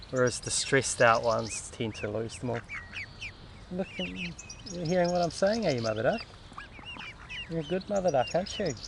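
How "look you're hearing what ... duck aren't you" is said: higher, soft pet talk; praising a mother duck in pet talk; cooing to a duck. Real, not acted. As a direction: sweet-talk the duck gently.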